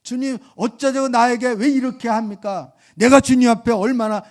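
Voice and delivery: high, loud whining plea to the Lord; complaining cry. Retold or imitated. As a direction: wail at God like a hurt believer. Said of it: "막 이렇게". (서운한 신자가 주님께 높고 크게 하소연하듯)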